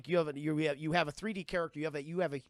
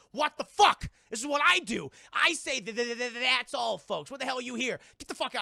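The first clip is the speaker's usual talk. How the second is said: high, loud, stuttering cartoon pig yelling; a furious stutter, high and loud; angry Porky Pig; 7 semitones higher, 8 dB louder than usual. Porky Pig yelling at the others who stole his catchphrase, high stuttering rage. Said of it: "And Porky Pig's like".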